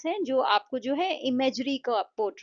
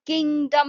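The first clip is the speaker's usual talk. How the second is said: Kingdom with a loud, drawn-out first syllable king; stress on KING; 4 semitones higher, 7 dB louder than usual. Shown as a demonstration. Teacher showing where the mark goes, kingdom stressed on king. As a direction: lean hard on king in kingdom. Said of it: "king पे आप stress दे रहे हो".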